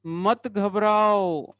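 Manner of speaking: high, very slow singsong call; drawn-out encouragement. Real, not acted. Teacher telling nervous students not to panic, long drawn-out call. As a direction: stretch it into a long, high call that falls away. Teacher reassuring class with chant.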